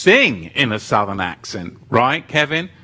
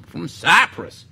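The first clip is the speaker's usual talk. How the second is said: louder, higher hillbilly Southern accent; 5 semitones higher, 5 dB louder than usual. Shown as a demonstration. Playing along with viewers who tempted him, hammed-up Southern accent. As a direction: ham up a North Georgia twang. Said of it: "just a little southern accent".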